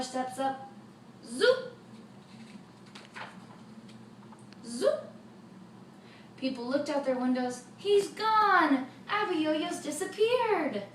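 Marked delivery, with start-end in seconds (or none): 1.2-5.1 rising zoop effect. A narrator's reading. Father makes the giant vanish, zoop. Magic wand sound. 7.8-10.8 high, loud joyful cry; excited shout. Acted. Townsfolk celebrate the giant vanishing, excited.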